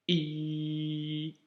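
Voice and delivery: normal talking voice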